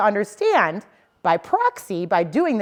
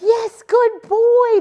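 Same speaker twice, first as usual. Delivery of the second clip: put-on high, slow, sing-song "good boy"; praise voice; 7 dB louder, much slower than usual. Shown as a demonstration. Trainer petting and praising the dog for sitting. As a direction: gush praise over the dog. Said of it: "I'm gonna be like".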